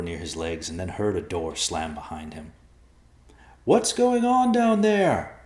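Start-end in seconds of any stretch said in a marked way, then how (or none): 3.6-5.4 loud, high, drawn-out yell; high-pitched distant shout. Acted. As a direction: holler it slowly down a hole. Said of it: "Ben yelled from above".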